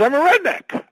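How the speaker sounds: high mock declaration. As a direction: raise it way up, mocking. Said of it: "no one says".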